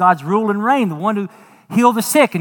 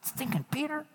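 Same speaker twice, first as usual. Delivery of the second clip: jealous disciples; 7 semitones higher, 8 dB quieter than usual. Retold or imitated. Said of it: "I was like".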